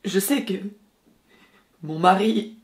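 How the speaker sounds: acted distressed outpouring to a lover; film monologue. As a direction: let the regret rise, then break into halting fragments. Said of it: "voyons si j'arrive à recréer cette sensation".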